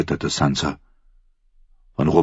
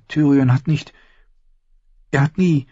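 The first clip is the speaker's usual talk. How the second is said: put-on higher, halting young voice; timid girl; 10 semitones higher than usual. A narrator's reading. Sansa blushing as she answers her aunt, hesitant. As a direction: stammer it out, embarrassed.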